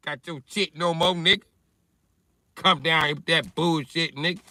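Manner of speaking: Mississippi taunting. Retold or imitated. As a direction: crow it up high like a proud thief daring you. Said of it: "And Mississippi like".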